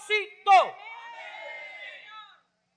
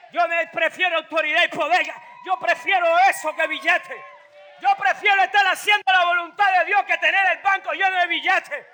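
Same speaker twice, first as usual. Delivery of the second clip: fiery preaching; 2 semitones higher, 6 dB louder than usual. Real, not acted. Preacher rouses the church about authority, loud fiery preaching. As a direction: rouse the congregation.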